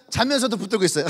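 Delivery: breaking into laughter; laughing. Real, not acted. Preacher joking to the congregation, laughing aloud. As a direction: laugh through the punchline.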